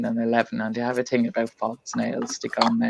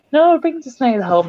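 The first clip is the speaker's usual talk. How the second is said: put-on child's plea; 12 semitones higher, 5 dB louder than usual. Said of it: "the boy was like".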